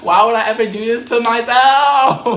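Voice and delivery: a high, loud cry of disbelief; high loud exclaim. Real, not acted. Teller wailing at own bad choice, high and loud. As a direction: wail it at yourself.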